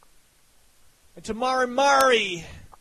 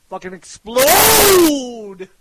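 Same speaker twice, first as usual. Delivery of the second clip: slow hyped shout; 9 dB louder, much slower than usual. Real, not acted. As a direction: draw out the words, yelling loud. Hyped rant, shouting the launch.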